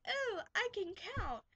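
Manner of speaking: put-on high, chirpy little-kid voice; mock little kid. Babysitter mimicking a toddler showing off, high kid voice. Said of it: "where they're like".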